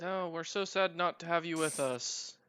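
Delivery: sarcastic, soft mock sorrow; overdone fake sadness, quiet; fake regret. Players sarcastically send NPC away.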